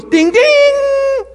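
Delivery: a squeaky bell-like cheer; mock happy chime. Healed person chiming happily, high squeaky voice. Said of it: "he goes".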